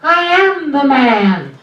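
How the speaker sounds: high voice insisting. Shown as a demonstration. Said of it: "my voice goes up high".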